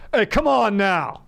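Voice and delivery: mild mock yell at a coyote; dog dad voice. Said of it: "my dog dad voice".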